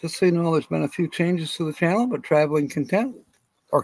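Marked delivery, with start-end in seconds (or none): none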